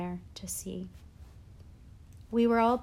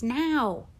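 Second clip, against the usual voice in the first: loud, higher urgent bark; shouted; 5 semitones higher, 7 dB louder than usual. A narrator's reading. Teacher hurrying slow set decorator, shouted. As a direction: shout to demand instant action. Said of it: "shouted Davenport in his face".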